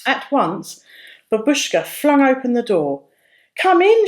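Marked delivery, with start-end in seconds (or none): 3.6-4.1 bright, much higher and louder welcome; beaming welcome. A narrator's reading. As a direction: throw the door open with a beaming welcome. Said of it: "she beamed".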